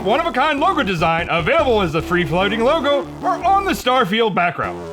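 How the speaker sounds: high, hammed-up commercial announcer; dumb announcer voice. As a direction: sell the merch like a cheesy radio ad. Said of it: "doing your dumb announcer voice".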